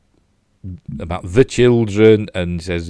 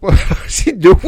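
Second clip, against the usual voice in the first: laughing while speaking; 7 dB louder, slower than usual. Real, not acted. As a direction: laugh through the question. Laughing at the clumsy scene.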